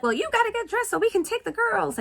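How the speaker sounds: high, fast stepmom voice; pushy stepmom. Retold or imitated. Stepmom telling dad to take the girls, pushy. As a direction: push it out high and quick.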